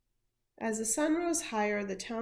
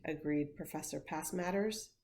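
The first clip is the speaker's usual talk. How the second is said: plain reading voice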